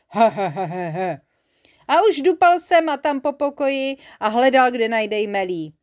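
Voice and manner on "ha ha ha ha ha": short acted monster laugh; gloating storybook cackle; croaking villain cackle. A narrator's reading. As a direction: chuckle villainously, falling in pitch. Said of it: "skřehotavý hlas strašného pytla".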